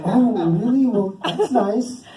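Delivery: high, drawn-out "that's nice"; mock polite reaction. Customers reacting to his drugs joke, mock politeness.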